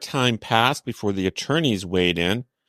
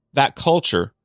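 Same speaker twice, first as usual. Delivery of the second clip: culture with the first syllable punched higher; stress on first syllable; 6 semitones higher than usual. Shown as a demonstration. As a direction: lean on the CUL in culture. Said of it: "emphasis on the first syllable".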